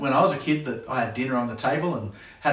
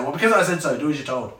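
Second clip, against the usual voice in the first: dismissive parent; faster than usual. Acted. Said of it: "So they just say".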